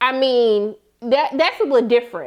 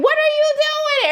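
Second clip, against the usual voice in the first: dad's outburst; 12 semitones higher than usual. Retold or imitated. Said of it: "The dad pushed him, like".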